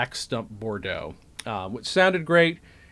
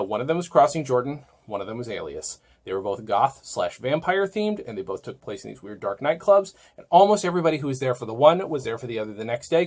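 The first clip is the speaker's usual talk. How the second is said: ordinary talk, nothing marked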